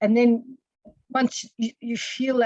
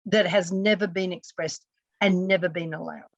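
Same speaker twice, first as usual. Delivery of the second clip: ordinary delivery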